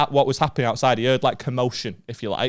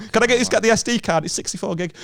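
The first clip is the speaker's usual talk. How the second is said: quick, higher, politely cheeky ask; mock polite request; 8 semitones higher than usual. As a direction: rattle it off as a polite favour. Asks the mugger for SD card.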